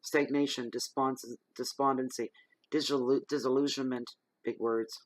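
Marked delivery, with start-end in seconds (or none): none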